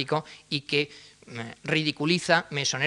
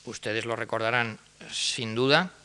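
usual speaking voice

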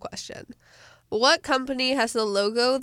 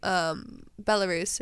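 normal talking voice

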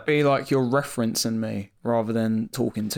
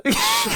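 shrieking high laugh. Real, not acted. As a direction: burst into a squeal of laughter. Laughing before the mock plea.

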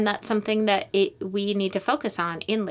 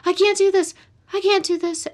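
imitating a desperate cry, higher and louder; panicked laboring mother; 11 semitones higher, 7 dB louder than usual. Midwife imitating a woman in labor giving up. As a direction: plead that you can't go on. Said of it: "during a contraction I've heard say".

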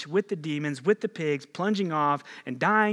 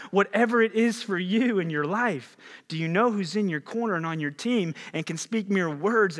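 louder, higher, driving list of whatevers; preaching at full force; 5 semitones higher, 4 dB louder than usual. Acted. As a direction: build each whatever like a rallying cry. Preaching climax, rising intensity.

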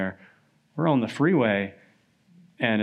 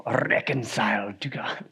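slow, whispered Scottish lilt; Scottish accent; slower than usual. Retold or imitated. Preacher imitating Lloyd-Jones's beloved sermon line, a slow Scottish whisper. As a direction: savour it slowly in a Scottish whisper. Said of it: "in that Scottish accent said".

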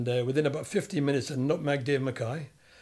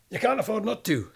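mock retort, higher and louder; Shankly's retort; 8 semitones higher, 4 dB louder than usual. Shankly overruling the other manager on the phone, mock loud retort. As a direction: snap back a punchline in the boss's voice. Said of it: "must have said".

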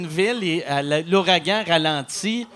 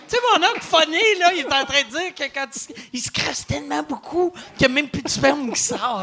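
put-on teen girl voice; 7 semitones higher than usual. Voices a teen niece to her friends.

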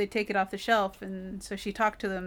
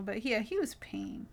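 ordinary talk

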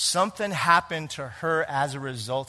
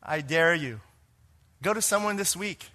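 ordinary delivery

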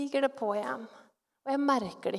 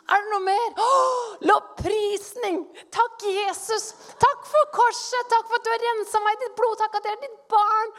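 high, loud burst of thanksgiving; frantic praise; 9 semitones higher, 4 dB louder than usual. Retold or imitated. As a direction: pile on the prayers with exaggerated fervour. Frantic praise, acting out prayer. (høylytt, overivrig lovprisning)

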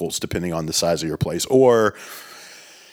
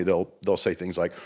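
ordinary talk

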